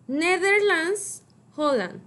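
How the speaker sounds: teacher modelling "Netherlands" slowly; slow careful model. Shown as a demonstration. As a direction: spell out "Netherlands" slowly for learners. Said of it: "I want you to repeat after me".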